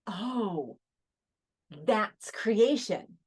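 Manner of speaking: slow, drawn-out awe as it clicks; dawning realization. Retold or imitated. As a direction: retell a revelation, stretching each word out. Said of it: "I got it immediately".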